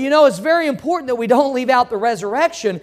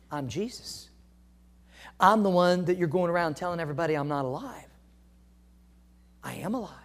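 soft voice of Jesus; 6 semitones lower, 10 dB quieter, faster than usual. Acted. Quiet retelling of the voice.

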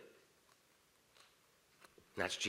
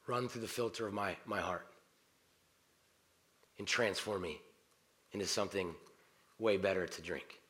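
heartfelt prayer; 2 semitones lower, 4 dB quieter than usual. Shown as a demonstration. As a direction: pray quietly, unhurried, pausing between phrases. Demonstrates a coffee prayer.

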